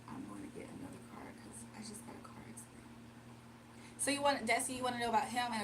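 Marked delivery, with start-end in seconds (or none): 0.1-2.8 whispered reading. Real, not acted. Reader whispering a client's question to herself, hushed and quick. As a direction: read the question under her breath.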